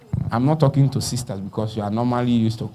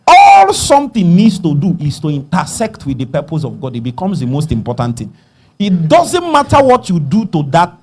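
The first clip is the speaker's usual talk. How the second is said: raised, high-pitched preaching voice; forceful preaching; 3 semitones higher, 5 dB louder than usual. Real, not acted. Preacher teaching on the purpose of God, forceful preaching. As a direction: drive the point home loudly, high on the key words.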